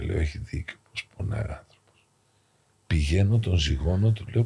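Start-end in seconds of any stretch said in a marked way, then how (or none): 0.2-2.1 a private whisper to himself; whispered aside. Retold or imitated. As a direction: whisper it as a pained thought. Priest's inner thought at bedside.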